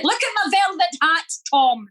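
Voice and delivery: high, put-on posh lady voice; funny voiceover. Friend dubbing an old film, mock high voice. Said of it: "voice over them".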